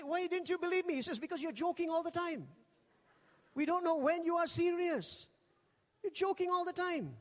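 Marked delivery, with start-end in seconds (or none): 0.0-0.9 higher, playful reproach; a light retold protest; mock indignation. Retold complaint to friend. 1.2-7.2 much higher, mock exasperation; imitating a friend's teasing complaint; exasperated friend. Friend explains his doubt.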